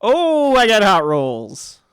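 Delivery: high, loud, drawn-out crowing; real excitement. Real, not acted. Game master delights in hot rolls, excited. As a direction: exclaim slowly and loudly over lucky dice.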